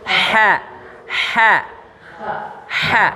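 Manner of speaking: ha pushed out as breath; breathy ha. Shown as a demonstration. Teacher showing the breath sound of ha. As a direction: breathe the ha out so air is felt. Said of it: "Coba Bapak gini".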